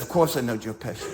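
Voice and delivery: low, soft, offhand reply, like it's nothing; cool understatement. Acted.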